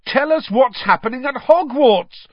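high, loud shout; urgent yell to someone leaving; bellowing. A narrator's reading. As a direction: bellow it out of a train window. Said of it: "Fred bellowed out of the window".

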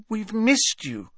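high, slow, tremulous plea; tremulous Hermione. A narrator's reading. Hermione telling Hagrid they missed him, tremulous. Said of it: "said Hermione tremulously".

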